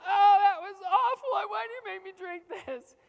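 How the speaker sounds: put-on high, loud childish wail of disgust; disgusted cry. Child wailing at parent over the drink. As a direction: whine it like a kid gagging.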